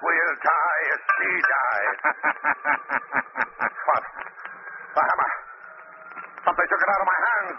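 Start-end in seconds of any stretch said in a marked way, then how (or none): 2.0-3.8 mad laugh. Acted. Madman laughing as the platform falls, crazed chuckle. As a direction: laugh at death itself.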